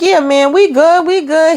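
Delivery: high, beaming we good; giddy reassurance. Retold or imitated. Gary grinning, assuring Aaron all is good, high. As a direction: brush off worries with a grin.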